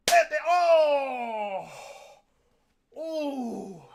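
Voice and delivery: slow drawn-out cry of pain; slow pained yelp; pained shout. Real, not acted. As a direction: yell it out as the last slap lands. Final painful slap.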